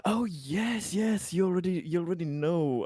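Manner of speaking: eager agreement. Acted. Host conceding Richard already knows Albert, eager.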